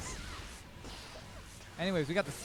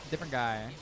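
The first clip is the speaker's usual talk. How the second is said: usual speaking voice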